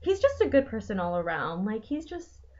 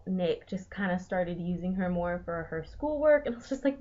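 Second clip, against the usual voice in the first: ordinary talk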